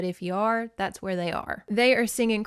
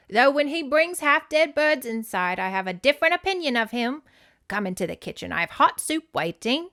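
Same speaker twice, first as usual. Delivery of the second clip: lilting, higher British great-aunt; bad British accent; 6 semitones higher, 3 dB louder than usual. Great aunt inviting guests into the kitchen, bad British accent. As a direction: bustle along in a hammed-up British accent. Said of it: "I don't know why I'm making her sound like".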